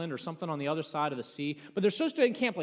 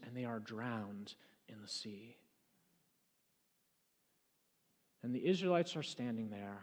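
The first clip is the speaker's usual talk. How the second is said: hushed telling; 7 semitones lower, 6 dB quieter than usual. Acted. Preacher softly describes the Israelites' new freedom. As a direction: let the awe settle quietly.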